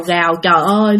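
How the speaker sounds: plain reading voice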